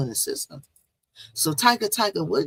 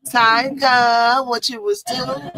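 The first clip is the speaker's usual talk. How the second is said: teasing call out; 6 semitones higher, 6 dB louder, slower than usual. Acted. Calling out to Tiger.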